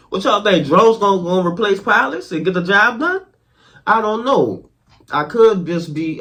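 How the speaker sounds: loud, high, heated ranting; angry rant. Real, not acted. Vlogger ranting at the government for not paying Marines, loud and high. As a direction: vent your outrage at the government.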